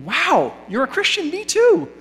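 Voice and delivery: high, delighted squeal of recognition; eager delight. Acted.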